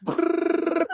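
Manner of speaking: high trilled machine-gun noise; high brrr of imitated bullets; imitated gunfire. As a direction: roll out a burst of mock gunfire. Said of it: "And then you just hear".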